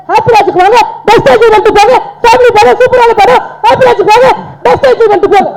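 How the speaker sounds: high, forceful chant urging everyone to come; threatening-sounding promo shout. Real, not acted. Young actor plugging the film to the audience, threatening-sounding shout. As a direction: belt out the film plug like an order. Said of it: "இவங்க மிரட்டுறீங்களா அப்படி".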